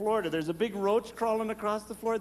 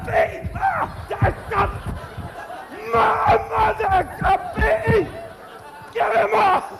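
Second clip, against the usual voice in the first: high, loud panicked shouting; freaked-out yelling; 7 dB louder, slower than usual. Retold or imitated. Son panics at a bee in his sweater on the way to church, high shouts. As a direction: panic like a bee is stuck on you. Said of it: "I freaked".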